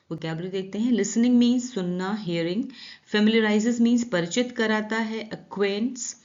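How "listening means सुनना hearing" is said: usual speaking voice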